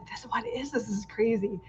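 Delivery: half-whispered, as if someone might overhear; hushed disbelief. Retold or imitated. Retelling the parking lot handoff, a hushed aside.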